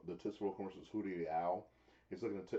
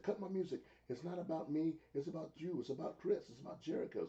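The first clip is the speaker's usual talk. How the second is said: mock wrestler promo; 8 semitones higher than usual.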